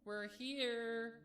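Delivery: high, loud happy call; called out happily. Acted. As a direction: sing it out to someone far away. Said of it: "Pinkamena called out happily".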